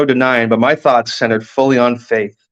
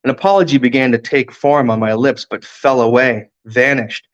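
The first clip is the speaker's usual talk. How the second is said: ordinary delivery, nothing marked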